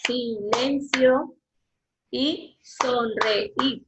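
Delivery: slow, ending in a whisper; teacher counting syllables. Shown as a demonstration. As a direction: count out "silencio y sonreír" slowly, syllable by syllable.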